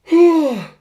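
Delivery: big high yawning groan. Real, not acted. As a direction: let out a loud open yawn. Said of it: "Sorry about that yawn".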